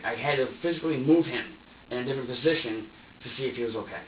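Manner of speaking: ordinary talk, nothing marked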